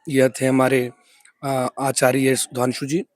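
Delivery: normal talking voice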